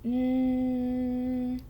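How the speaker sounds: N as a long, level nasal hum; letter sound. Shown as a demonstration. Teacher demonstrates the new letter, letter sound. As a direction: hold N as a continuous nnn, not the letter name. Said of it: "put your teeth together".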